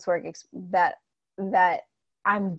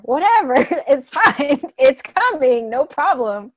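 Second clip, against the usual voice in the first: carefree shrug; 5 semitones higher, 8 dB louder than usual. Pretend. Voicing money's carefree attitude.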